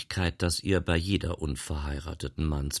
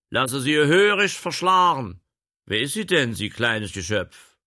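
loud, high, lordly orders; commanding gentleman; 7 semitones higher, 8 dB louder than usual. Acted. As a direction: order the coachman about.

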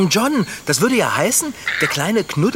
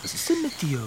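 put-on cooing baby talk; 9 semitones higher, 4 dB quieter than usual. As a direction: coo tenderly at the little koala. (zärtlich gurrend, hoch und leise)

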